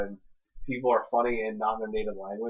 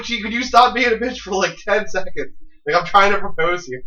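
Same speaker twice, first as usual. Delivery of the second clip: high, loud mock exasperation; exasperated retort; 8 semitones higher, 8 dB louder than usual. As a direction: replay an exasperated plea, pitched way up. Retold snap at difficult girlfriend.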